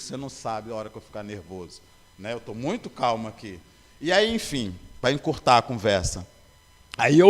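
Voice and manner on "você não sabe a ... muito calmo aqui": muttered under the breath, much softer; grumbling inwardly at the nurse, hushed; talking to himself. Retold or imitated. Patient in ICU answering the nurse in his head, hushed and sarcastic. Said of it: "e eu comigo".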